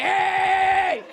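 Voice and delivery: loud laughing "Hey!" catcall; catcall shout. Retold or imitated. Comic mimics men catcalling her, loud shout. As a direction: bark it like a street catcaller.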